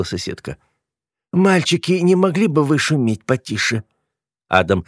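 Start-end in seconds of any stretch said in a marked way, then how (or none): 1.3-3.8 lightly voiced schoolteacher, higher pitch; neighbour teacher's reproof. A narrator's reading. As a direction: politely chide noisy boys. Neighbour, old teacher, asks for quiet.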